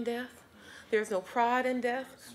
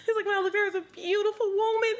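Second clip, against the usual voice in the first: high, tearful voice breaking as she speaks; crying; 10 semitones higher, 5 dB louder than usual. Real, not acted. Grieving daughter remembers her mother, crying through the words. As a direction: speak through real tears, straining upward.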